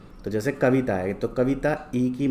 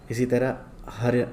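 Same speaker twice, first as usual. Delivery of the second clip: ordinary talk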